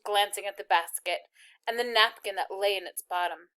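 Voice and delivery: ordinary delivery, nothing marked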